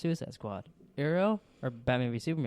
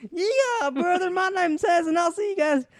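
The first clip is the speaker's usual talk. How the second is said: much higher, loud, hammy cowboy pitchman; cowboy car salesman; 8 dB louder than usual. Pretend. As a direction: hawk your car show like a cowboy. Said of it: "How come I sound like a cowboy".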